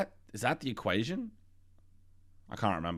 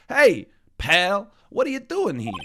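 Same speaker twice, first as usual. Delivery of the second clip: higher, louder gruff detective call; Gumshoe voice; 6 semitones higher, 8 dB louder, slower than usual. Acted. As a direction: boom it out like a pushy cop. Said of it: "It's the only voice that I can't go in and out of".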